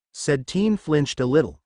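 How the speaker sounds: plain reading voice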